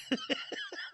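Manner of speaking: squealing high laugh. Real, not acted. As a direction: burst into a high cackle. Laughs at the clip.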